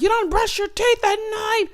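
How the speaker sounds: high-pitched scandalized crowd voice; hammed-up collective shock; mock uproar. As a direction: imitate outraged viewers, high and exaggerated. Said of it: "gonna be in an uproar like".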